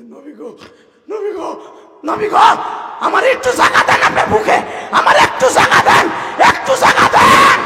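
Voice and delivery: high call escalating to a hoarse scream; acted sleeper's frantic begging; desperate plea. Acted dream plea to the Prophet.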